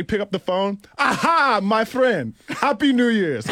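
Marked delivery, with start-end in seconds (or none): 1.0-1.6 loud, high Nigerian father greeting; imitating his Nigerian father's hearty phone greeting; Nigerian dad voice. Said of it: "my Nigerian father used to say". 1.6-2.3 put-on "my friend" high and loud in a Nigerian accent. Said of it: "my Nigerian father used to say". 2.5-3.5 loud, high Nigerian father greeting; imitating his Nigerian father's hearty phone greeting; Nigerian dad voice. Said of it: "my Nigerian father used to say".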